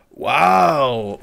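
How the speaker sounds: big, loud, drawn-out wow; impressed wow. Real, not acted. Player reacting to the personal assistant, loud amazed exclamation. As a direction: let it swell in amazement.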